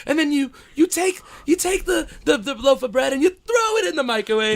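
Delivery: high, fluttery Julia Child impression; lightly imitating Julia Child's accent; retelling his cooking-show Julia Child voice. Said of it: "I'm doing this Julia Childs, like, accent".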